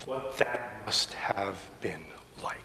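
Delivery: near-whisper, low and spaced out; very soft, low imagining; hushed wonder. Real, not acted. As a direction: let it fall almost to a whisper. Inviting listeners to imagine restored sight.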